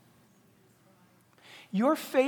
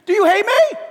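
higher, loud; child's outcry; 13 semitones higher, 13 dB louder than usual. Retold or imitated. A disciplined child asking a parent, high and loud. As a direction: cry out like an upset child. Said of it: "They don't say".

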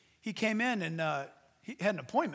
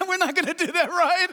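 louder, higher laughing plea; nervous laughing; 9 semitones higher, 7 dB louder than usual. Retold or imitated. Church people balking at foot washing, laughing nervously. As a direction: laugh it off nervously, hoping it's a joke.

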